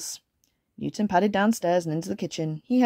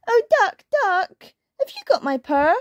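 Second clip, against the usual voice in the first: high, pleading kitten voice; squeaky storybook kitten; little kitten; 12 semitones higher, 5 dB louder than usual. A narrator's reading. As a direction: ask it like a tiny anxious kitten. Kitten asks duck in story.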